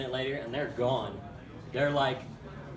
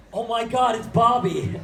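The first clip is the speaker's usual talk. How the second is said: excited dad; 6 semitones higher, 7 dB louder than usual. Retold or imitated. Dad recognizing the turtle.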